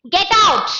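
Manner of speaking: sharp rise in pitch and volume; loud, high command. Shown as a demonstration. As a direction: order someone out forcefully. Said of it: "it's louder and high in pitch".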